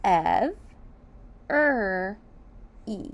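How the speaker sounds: Every split into Ev, er, e; syllable by syllable. Shown as a demonstration. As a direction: clap out each syllable of every. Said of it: "Every is three syllables".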